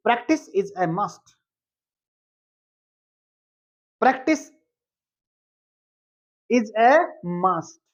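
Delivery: very slow, word by word; very slow model sentence. Shown as a demonstration. Teacher slowly modelling the phrase for students. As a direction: draw out each word for learners. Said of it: "तो क्या बोलेंगे?".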